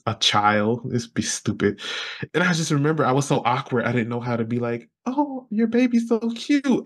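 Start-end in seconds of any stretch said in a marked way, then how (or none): 5.1-6.8 high, slow cooing compliment; sweet high baby talk. Retold or imitated. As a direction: coo it slowly and high. Awkward compliment imagined.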